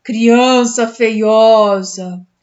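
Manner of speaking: put-on the ghost taunting the child in a cavernous voice. A narrator's reading. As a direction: drag it out like a spooky ghost. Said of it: "diz com voz cavernosa".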